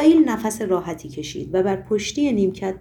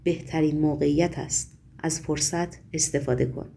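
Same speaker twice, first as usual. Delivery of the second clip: low, soft, insinuating murmur; hushed, low-pitched and pointed; meaningful undertone; 5 semitones lower, 5 dB quieter than usual. A narrator's reading. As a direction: murmur a knowing hint under your breath. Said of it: "با لحن پرمعنایی زیر لب گفت".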